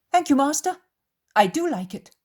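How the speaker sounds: high, loud character voice; high-pitched, overeager-sounding thanks; voiced Judas. Narrator voices Judas answering Jesus.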